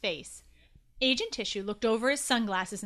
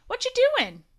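eager call; 13 semitones higher, 8 dB louder than usual. Acted. Boy calls out to stranger.

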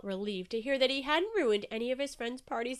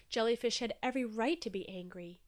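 ordinary delivery, nothing marked

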